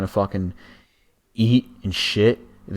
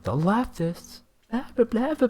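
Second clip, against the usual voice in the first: mocking impression; 14 semitones higher, 5 dB quieter than usual. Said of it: "He's like".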